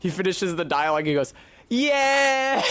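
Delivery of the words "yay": high, louder squeal of delight; ecstatic yay. Retold or imitated. Limmy hearing a real Scottish actor, high and delighted. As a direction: cheer like a delighted child. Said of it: "He's so ecstatic".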